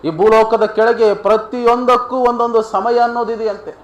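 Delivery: ringing, high-pitched sermon declaration; emphatic preaching. Real, not acted. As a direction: drive the point home to the congregation.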